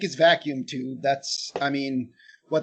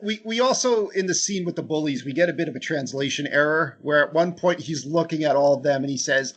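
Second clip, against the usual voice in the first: ordinary talk, nothing marked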